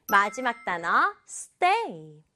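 ordinary delivery

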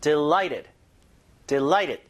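"Delighted" with a punched middle syllable, "light"; syllable stress demo. Shown as a demonstration. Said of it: "힘을 여기다 주라는 얘기예요". ("Delighted"의 "라이"에 힘주어)

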